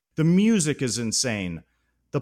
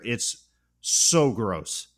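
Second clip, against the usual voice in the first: normal talking voice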